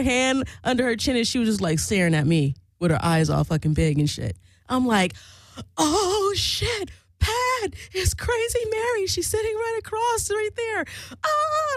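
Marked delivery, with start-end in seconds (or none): 5.8-11.7 retold scream. Telling Pat that Crazy Mary is right there, mock screaming. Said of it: "I was, like, screaming and shit".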